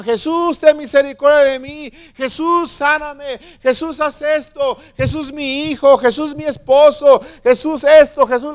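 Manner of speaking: high-pitched mock crying out to Jesus; imitated crowd pleas. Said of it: "le gritaban".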